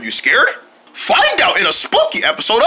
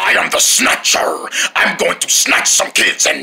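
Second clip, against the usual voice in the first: creepy villain whisper; 6 semitones lower than usual. Acted. Villain introduces himself.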